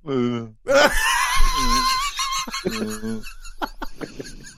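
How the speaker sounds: groaning zombie moan dissolving into laughter; zombie noise. Pretend. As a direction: moan like a zombie, then crack up. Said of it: "What is that?".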